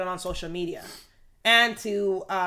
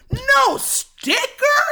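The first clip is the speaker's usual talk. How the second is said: very high, loud, drawn-out question; mimicked outraged contestant; 8 dB louder, much slower than usual. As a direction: shriek it slowly in disbelief to the crowd. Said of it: "she lifts it up under her head and goes".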